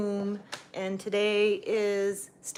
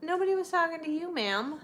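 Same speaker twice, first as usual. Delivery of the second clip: mock scolding; 8 semitones higher, 3 dB louder than usual. Scolding the cat playfully.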